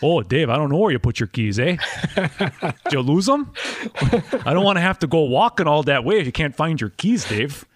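quick, folksy Wisconsin accent. Shown as a demonstration. Host answering the lost car keys, Wisconsin accent. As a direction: slip back into a Wisconsin accent. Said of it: "my Wisconsin response would be".

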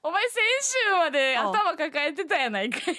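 high, loud Kansai tsukkomi; loud teasing retort. Real, not acted. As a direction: snap back at your partner, half laughing.

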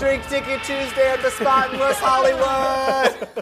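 high slow yelled promo; screamed last words. Pretend. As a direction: scream it as the plane drops. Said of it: "That's what I would scream".

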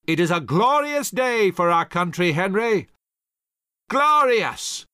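loud, rising, exultant call; triumphant general hailing his colonel; calling down joyfully. A narrator's reading. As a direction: shout the victory down the ridge. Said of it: "called down to Colonel Knox".

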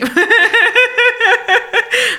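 bursting into high laughter; loud high laugh. Real, not acted. Laughs at the teasing. (risata acuta e forte)

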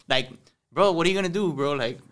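ordinary delivery

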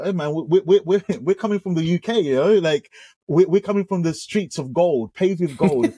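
playful imitation of a proud young visitor; mock swagger. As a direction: brag in fun, voice lifted and amused. Bragging self-quote, mocking his past pride.

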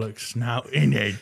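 comic put-on British accent; mock British accent. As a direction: fake British, punched on the tag question. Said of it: "adopt a British accent".

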